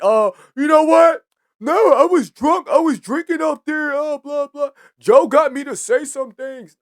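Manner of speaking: mock him pleading he was drunk, high and drawn out; mocking T.I. excuse. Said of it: "now you wanna back out on this".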